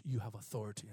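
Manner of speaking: low, soft assurance; calm. Retold or imitated. Old man assuring young believer of authority, calm and soft. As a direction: say it calmly and quietly. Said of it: "He, calmly".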